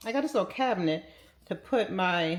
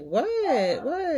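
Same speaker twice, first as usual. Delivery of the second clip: surprised what; 10 semitones higher than usual. Retold or imitated. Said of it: "And I was like".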